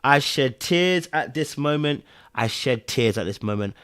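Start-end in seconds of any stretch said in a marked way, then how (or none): 0.0-2.0 slow, deliberate admission; emphatic confession. Real, not acted. Viewer admitting the scene made them cry, slow and emphatic. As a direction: spell out the confession slowly.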